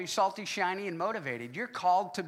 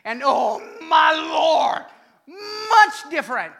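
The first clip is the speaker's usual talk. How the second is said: loud, high, slow cry of amazement; rapturous food-lover exclaiming; excited outcry; 8 semitones higher, 12 dB louder, slower than usual. Real, not acted. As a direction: shout it out like the taste overwhelmed you. Raving about a restaurant meal.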